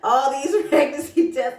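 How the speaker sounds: high and loud through laughter; joyful laughing speech. Retold or imitated. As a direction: laugh the words out in excitement. Showing husband the tests.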